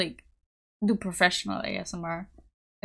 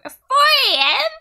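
very high, loud, drawn-out disbelief; incredulous shriek; 10 dB louder, slower than usual. Real, not acted. Wishing someone a good work day at four A M, incredulous shriek. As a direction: shriek it slowly as a stunned question. Repeating shock at early start.

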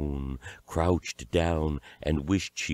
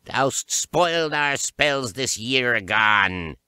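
high, drawn-out witch cry; slow accusing witch yell, pitched high; yelling witches; 8 semitones higher, 4 dB louder than usual. A narrator's reading. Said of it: "yelled the witch bodies".

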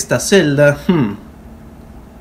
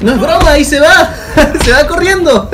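laughing; 12 semitones higher, 4 dB louder than usual. Real, not acted. Watching villain escape, laughing.

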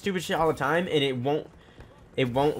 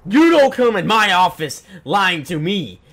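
loud, higher-pitched, slow angry imitation; retold yelling principal; 5 semitones higher, 9 dB louder, slower than usual. Principal accusing a student of lying, retold angry yell. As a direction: bellow it as the outraged principal. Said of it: "he says in his loudest voice".

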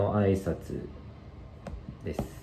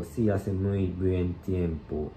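very slow Sí hace muy buen tiempo, word by word; slow model reading; much slower than usual. Shown as a demonstration. Teacher slowly reads the last line and its English for learners. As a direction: drag each word so learners catch it. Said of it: "最後、".

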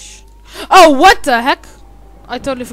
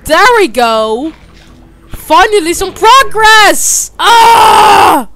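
much higher and louder, slow cheer; triumphant shout; 8 semitones higher, 11 dB louder, much slower than usual. Real, not acted. Gamer cheering finally making progress, triumphant yell. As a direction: cheer at last.

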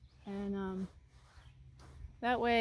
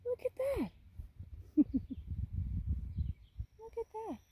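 pet talk. Real, not acted. Cooing to the horse.

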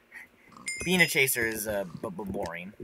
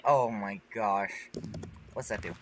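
ordinary talk

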